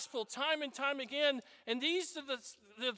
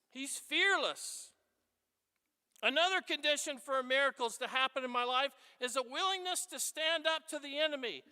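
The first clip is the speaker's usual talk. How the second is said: ordinary talk, nothing marked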